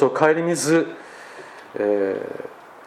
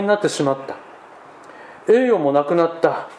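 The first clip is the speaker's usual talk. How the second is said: solemn dramatic reading; 4 semitones higher than usual. Acted. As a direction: read it like a mournful testimony. Reads memoir text aloud. (厳粛な朗読)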